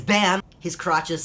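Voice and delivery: loud, high bam; excited reveal. Acted. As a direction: slam the reveal home. Big reveal of zoomed photo.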